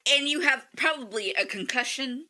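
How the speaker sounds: ordinary talk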